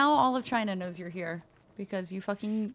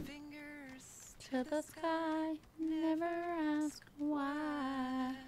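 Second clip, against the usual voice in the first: much softer, slow, half-spoken chant; murmured motto; 4 semitones higher, 14 dB quieter, much slower than usual. Pretend. Killer softly recites a motto after a kill. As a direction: croon it under the breath.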